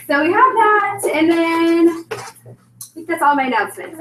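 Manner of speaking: breathless after talking fast; winded, catching her breath. Real, not acted. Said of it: "I'm, like, out of breath".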